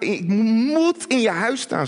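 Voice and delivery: "moet" drawn out and hit loud; stress on MOET. Acted. Preacher insists it is no maybe, heavy stress on "moet". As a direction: lean hard and long on the word "moet". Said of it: "Er staat niet, "Nou, misschien wil ik".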